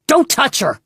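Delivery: high, loud protective shout. A narrator's reading. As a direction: yell to stop him touching her. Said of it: "Poe shouted".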